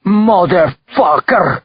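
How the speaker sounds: put-on high, drawn-out yell in Russian accent; yelled curse. A narrator's reading. Belova hears his sons insulted, enraged yell. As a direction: spit out a slow, furious Russian-accented yell. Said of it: "yelled Belova".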